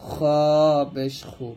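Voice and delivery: long খ with friction at the top of the throat; throaty, scraped খু; sound from throat's end. Shown as a demonstration. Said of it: "গইন খ গলার শেষ থেকে উচ্চারণ করব". (গলার শেষ থেকে টেনে খ পেশ খু)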